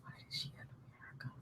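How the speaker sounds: hushed unvoiced whisper. Real, not acted. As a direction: whisper it so nobody hears. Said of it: "Why are you whispering?".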